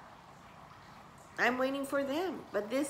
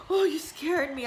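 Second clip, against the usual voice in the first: higher, louder mock-frightened cry; retold scare; 6 semitones higher, 5 dB louder than usual. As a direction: re-enact being scared and near tears. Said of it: "I'm crying and I'm getting scared".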